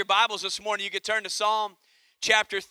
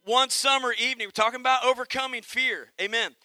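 ordinary talk, nothing marked

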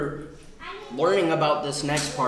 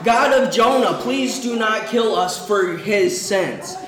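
pleading prayer; 5 semitones higher, 4 dB louder than usual. Acted. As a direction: beg God to spare them. Sailors pray for mercy.

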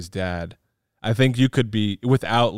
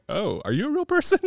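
much higher, mock surprised question; mock amazement; 12 semitones higher than usual. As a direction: pretend to be amazed someone talks like that. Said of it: "I'm like".

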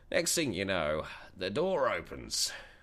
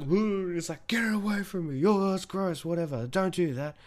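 put-on high panicky squeal of a grossed-out teen; squealing mate; 12 semitones higher than usual. Imitating his mate recoiling from the wobbling dildo, high and squealing. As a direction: shriek it, recoiling in disgust.

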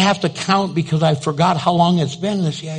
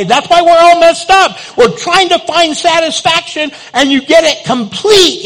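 preaching climax; 10 semitones higher, 5 dB louder than usual. Real, not acted.

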